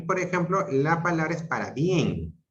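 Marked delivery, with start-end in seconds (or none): 1.4-2.4 "para bien" as one word, weight on the E of "bien"; stress on BIEN. Shown as a demonstration. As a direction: land the stress on "bien", then fall. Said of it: "la mayor fuerza de la E".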